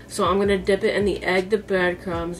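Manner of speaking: normal talking voice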